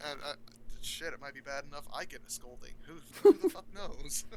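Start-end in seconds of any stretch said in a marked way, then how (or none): 2.8-4.2 loud, high, cracking into laughter; laughing outburst. Real, not acted. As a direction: blurt it out laughing.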